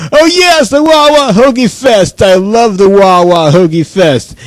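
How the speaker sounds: high-pitched burst easing down, singsong; gushing excitement. Pretend. Fan hearing of Hoagie Fest, gushing excitement. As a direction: burst in high with hammed-up delight.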